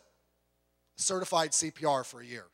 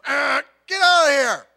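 mock angry driver; 7 semitones higher, 10 dB louder than usual. Angry driver brushing off others.

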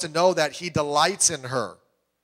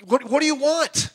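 confused husband; 9 semitones higher, 5 dB louder than usual. Retold or imitated.

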